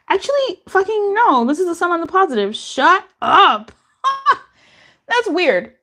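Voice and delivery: high, sudden exclaims breaking into a laugh; surprised outburst at the cards; startled excitement. Real, not acted.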